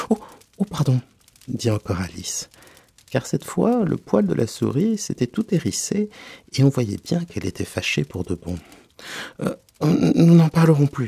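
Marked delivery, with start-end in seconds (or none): 0.1-1.0 high gasped oh, then sorry; startled apology. Acted. Alice realises she offended the mouse, high and flustered. 9.4-11.1 high, loud hesitant promise; flustered placating. Acted. Alice promises the angry mouse to stop, flustered.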